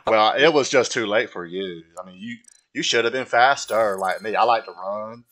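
put-on simple southern man's accent; Forrest Gump. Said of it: "I gotta talk like Forrest Gump".

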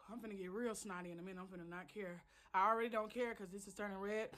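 very soft quick mumble; mumbling. Real, not acted. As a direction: mutter it under the breath. Suffering heat, mumbling to self.

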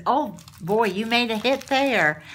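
ordinary talk

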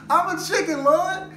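high, loud mock scream of terror; retold scream. Retelling his brother on the roller coaster drop, a high loud mock scream. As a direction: shriek it like a scared big guy on the drop. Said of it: "He screamed".

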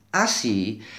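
normal talking voice

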